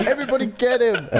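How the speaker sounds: high, laughing mock call. Mock rallying cry, laughing.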